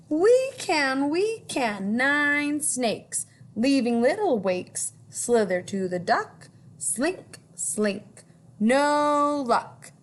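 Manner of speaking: put-on lilting read-aloud for children; storytime reading. A narrator's reading. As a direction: read it like a picture book to kids. Reading rhyming book aloud.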